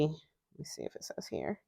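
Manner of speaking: whispered to herself. Real, not acted. Checking the box, whispering to herself. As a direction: whisper it under your breath.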